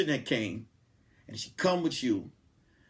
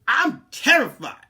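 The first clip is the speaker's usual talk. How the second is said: higher and louder, mock terror; terrified; 9 semitones higher, 8 dB louder than usual. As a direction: act scared out of your mind. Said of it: "I'm like".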